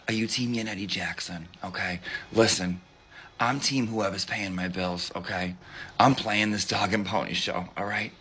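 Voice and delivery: low confiding voice. Pretend. Confiding about her rival, low and conspiratorial. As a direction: tell it low, like a secret. Lowered gossip character voice.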